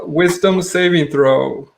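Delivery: ordinary talk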